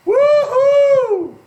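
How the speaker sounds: a high, loud whoop of glee. Real, not acted. Host whooping to his co-host about the heat, high and loud. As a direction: let out a big, high woo-hoo.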